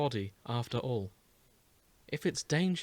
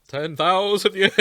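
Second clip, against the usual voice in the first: mock dramatic echo; 6 semitones higher, 9 dB louder than usual. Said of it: "I'm gonna keep doing this".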